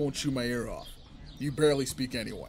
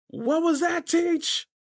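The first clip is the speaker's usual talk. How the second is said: high, loud call across the field; yelling to a distant teacher; 7 dB louder, much slower than usual. Acted. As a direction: shout over to the teacher. Calling out across archery field.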